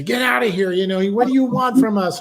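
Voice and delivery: high, loud retold outburst; going crazy. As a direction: imitate her flipping out and ordering him away. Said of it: "She went crazy".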